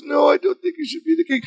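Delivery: high, hurried tearful plea, imitated; hammed-up high whimpering objection; mock crying. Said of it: "He's crying".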